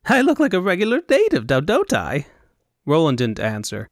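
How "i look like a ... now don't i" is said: high Elvunian fop. Acted. Fop preening.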